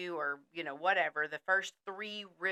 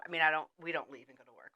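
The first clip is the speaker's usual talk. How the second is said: ordinary talk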